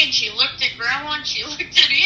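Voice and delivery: usual speaking voice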